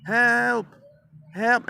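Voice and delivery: loud, high cries of help; retold yelling. Father retells kids yelling for help from upstairs, yelling. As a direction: yell it high, drawn on one note. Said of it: "we were yelling".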